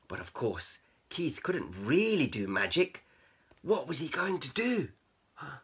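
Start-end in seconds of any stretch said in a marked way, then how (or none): none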